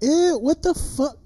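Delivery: high-pitched, appalled recoil; imagined shocked disgust; disgusted outburst. Acted. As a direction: react as if revolted. Imagined disgusted reaction to seeing it.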